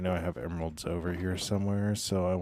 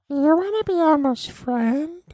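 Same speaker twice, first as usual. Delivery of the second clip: much higher and louder squeaky Elmo; 13 dB louder than usual. Pretend. Chat asks for Elmo and the streamer squeals as Elmo. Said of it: "do Elmo".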